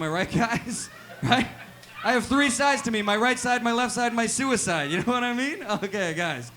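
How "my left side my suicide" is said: ordinary talk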